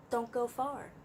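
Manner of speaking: ordinary delivery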